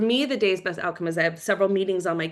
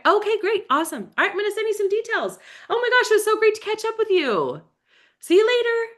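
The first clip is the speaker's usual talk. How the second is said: much higher, bright goodbye; high, cheery phone farewell; bubbly sign-off; 12 semitones higher than usual. Retold or imitated. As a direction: wrap up the call with a beaming send-off. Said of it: "if you reach out to somebody".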